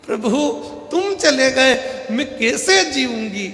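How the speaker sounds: high, hammed-up wailing of a widow; mock wailing wife. Wife wails over her husband's body, hammed-up and high. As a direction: wail for the husband, overdone and theatrical. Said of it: "पत्नी धाड़ धाड़ के रोने लगी".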